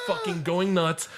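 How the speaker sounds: normal talking voice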